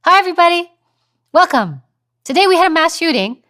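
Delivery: loud, very high, perky news greeting; exaggerated high voice, unfit for the news; high-pitched newsreader. Shown as a demonstration. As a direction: chirp grim news in a mock high voice. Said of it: "in the high-pitch, fast way".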